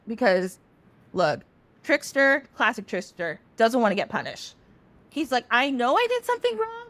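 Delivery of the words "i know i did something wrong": high, whiny admission of guilt; sheepish confession. Retold or imitated. Eshu admitting fault in the night, high and sheepish. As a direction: squeak it out like a caught trickster.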